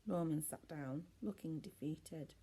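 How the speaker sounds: ordinary delivery, nothing marked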